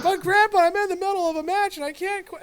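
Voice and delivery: very high, loud mock kid whine; whiny kid pleading. Boy begging grandpa to finish his match, high loud whine. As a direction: squeal it like a desperate twelve-year-old.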